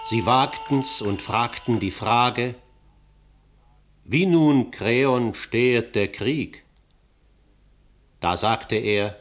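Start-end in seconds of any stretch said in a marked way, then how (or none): 4.0-6.7 loud, forceful declaimed question; bold question. Acted. Elders daring to ask Kreon about the war, loud and bold. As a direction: call it out in a full voice.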